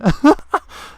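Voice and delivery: bursting into a high, loud cackle; loud laugh. Real, not acted. Laughing out loud at a friend who ate the wrapper.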